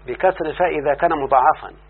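ordinary talk

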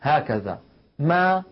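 ما said slowly with the mouth wide open. Shown as a demonstration. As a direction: stretch ما with an open jaw. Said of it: "انفتاح للفم".

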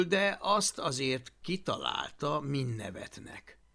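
plain reading voice

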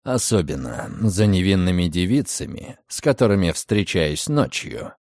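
deep, dry, slightly mocking; low teasing male voice. A narrator's reading. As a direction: coolly tease her. Redfern teasing Margaret. (низкий насмешливый голос)

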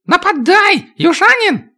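high, shrill dwarf yell; dwarf battle cry. Acted. Dwarf yells during fight.

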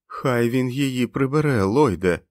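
a terrified man's whiny plea; whining. A narrator's reading. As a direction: whimper it out in fear. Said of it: "проскиглив темний чоловік".

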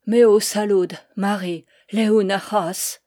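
prière lente, en breton; réciter l'Ave en breton avec ferveur. Acted. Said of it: "j'entends aussi sa prière". (le père prie Marie avant de mourir, lent)

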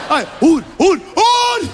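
lachend roepen naar buiten; hard en hoog uitroepen; roepend. Acted. Driver shouts at passerby. (roept lachend naar een voorbijganger)

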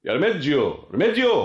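rising, high-pitched call. Acted. Calls Remedio over to him.

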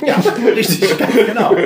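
high, loud laughing yes; real laughter through the words; laughing agreement. Real, not acted. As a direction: agree while cracking up.

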